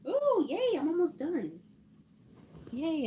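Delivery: high, soft little cheer; delighted squeal. Real, not acted. Quilter to herself on nearing the end, delighted. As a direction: squeal happily to yourself.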